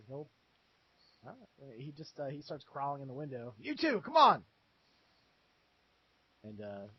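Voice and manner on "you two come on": high, urgent summons; rally two companions to follow; pitched-up hurried call; guard calling others. Acted. Guard calls to his companions.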